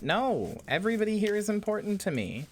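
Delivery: high-pitched, emphatic protest; indignant reassurance. Real, not acted. Streamer scolding chat for doubting they matter, indignant.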